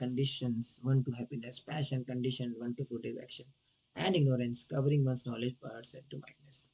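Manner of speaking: very slow, deliberate translation read-out. Acted. Teacher reading the verse translation to the devotees, very slow. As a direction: spell out each phrase for the class. Reads English translation slowly.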